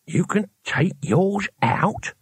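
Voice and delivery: slow, high toothless amazement; old man marvelling through bare gums; awed. A narrator's reading. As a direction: hushless wonder, drawn out and lisping. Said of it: "Cohen's very voice radiated awe".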